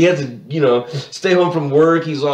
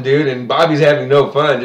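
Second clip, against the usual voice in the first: ordinary delivery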